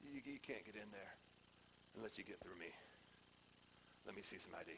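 low, very quiet doorman voice; low-voiced, understated gatekeeper; bouncer. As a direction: mutter it like a club bouncer. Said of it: "a bouncer at some club".

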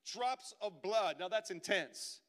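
loud, high impassioned sermon; fervent pulpit cry, slow and pleading on the quote; full-force preaching. Acted. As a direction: preach it out to the whole room.

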